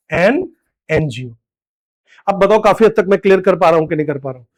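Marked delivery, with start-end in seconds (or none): 0.1-1.3 "एन NGO" said slowly, each sound separate; slow demo. Shown as a demonstration. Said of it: "तो हमको लिखना पड़ेगा". (धीरे-धीरे बोलकर दिखाना)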